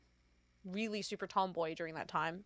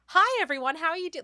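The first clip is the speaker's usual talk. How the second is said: high, bright greeting voice, higher; chirpy customer service greeting, higher; 8 semitones higher, 5 dB louder than usual. Retold or imitated. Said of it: "like, the customer service voice".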